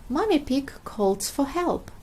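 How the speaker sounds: ordinary delivery, nothing marked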